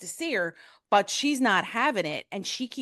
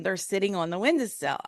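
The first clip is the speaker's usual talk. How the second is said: usual speaking voice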